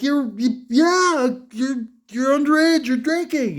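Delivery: high, sputtering imitation of an outraged father; mock scolding dad. Father scolding his underage son for drinking, mock outrage. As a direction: splutter like a scandalised dad.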